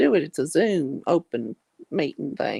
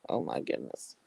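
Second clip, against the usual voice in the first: breathy whisper, much softer; whispered to herself; 13 semitones lower, 11 dB quieter than usual. Real, not acted. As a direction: say it under your breath. Muttered aside about her knee.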